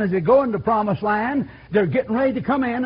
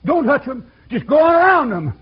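louder, climbing exhortation; rising preaching; 4 semitones higher, 5 dB louder than usual. Real, not acted. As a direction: build the fire in the sermon. Preaching builds.

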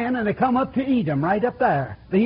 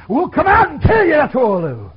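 louder, higher threat; threatening Edom; 5 semitones higher, 6 dB louder than usual. Retold or imitated. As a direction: bark a warning to the intruders. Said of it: "He says".

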